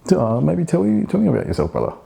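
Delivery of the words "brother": ordinary talk, nothing marked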